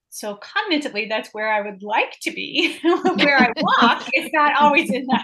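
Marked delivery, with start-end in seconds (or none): 2.8-5.2 slow, laughing admission. Real, not acted. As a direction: laugh it out, louder. Laughing confession after laugh.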